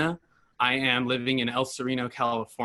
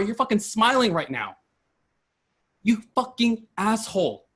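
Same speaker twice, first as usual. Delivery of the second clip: higher, furious outburst at the chief; real anger; 7 semitones higher than usual. Real, not acted. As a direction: let the anger spill out, pitch climbing. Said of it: "I am so mad right now".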